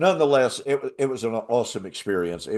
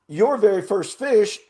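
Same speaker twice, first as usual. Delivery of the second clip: high, louder excited praise; excited guide; 8 semitones higher, 4 dB louder than usual. Retold or imitated. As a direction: pitch it up like the thrilled guide.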